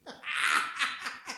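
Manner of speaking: a soft real laugh at his own punchline. Real, not acted. Preacher laughing softly at the usher joke, soft real laugh.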